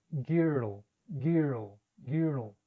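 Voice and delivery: put-on "girl" said as "giro" with a Spanish vowel; Spanish learner error. Shown as a demonstration. Teacher imitating the learners' mistake. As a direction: mimic the typical Spanish "giro". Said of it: "cometer errores al pronunciar".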